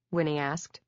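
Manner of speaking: ordinary delivery, nothing marked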